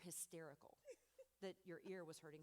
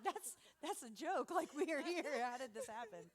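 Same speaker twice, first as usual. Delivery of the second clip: amazed disbelief; 5 semitones higher, 8 dB louder than usual. Retold or imitated. Seeing daughter in school ad.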